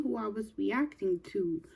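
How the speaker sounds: usual speaking voice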